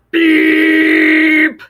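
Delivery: loud long honking beep like a tractor horn. Acted. Reading the tractor scene, loud horn beep. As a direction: blast it out like a horn.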